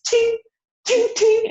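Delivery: very high, loud metallic ting sounds; crop hitting droid. Acted. GM imitating the crop striking a repair droid, very high metallic tings. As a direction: ring out each clang like metal striking. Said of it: "and she goes".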